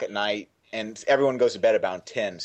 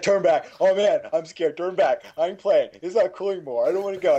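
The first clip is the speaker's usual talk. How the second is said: higher, pleading imitation of a frightened boy; hammed-up kid panic; mock scared kid; 7 semitones higher than usual. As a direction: plead to turn back like a spooked twelve-year-old. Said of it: "He got so scared".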